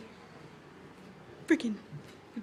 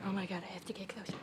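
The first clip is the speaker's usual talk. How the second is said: quick unvoiced whisper; fast whisper; 8 semitones lower, 10 dB quieter, much faster than usual. Real, not acted. Whispering fast while creeping toward the bird. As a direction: rush it under your breath.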